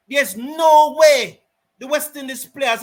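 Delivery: outraged commentator rant; angry rant. Real, not acted. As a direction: hammer the point home. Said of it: "my apology for that rant".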